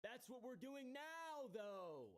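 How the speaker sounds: high, slow, drawn-out jeer; exaggerated mockery. Commentator jeering at the man's claimed accountability, exaggerated mockery. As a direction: stretch the words out in mocking singsong. Sarcastic jab at the man's excuses.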